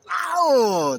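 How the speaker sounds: sudden loud high yelp of a biting lion; mock lion chomp. Retelling the lion eating the child, a loud yelp.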